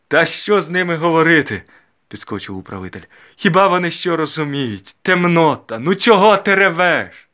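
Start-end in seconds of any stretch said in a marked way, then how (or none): 0.1-1.6 loud, high, scornful shout; angry outburst. A narrator's reading. Steward breaking in on weeping peasants, angrily. 3.4-7.3 loud, high, drawn-out rebuke; angry scolding. A narrator's reading. Steward scolding the weeping mother, loud and scornful.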